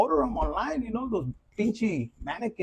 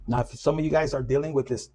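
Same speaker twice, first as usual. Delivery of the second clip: ordinary delivery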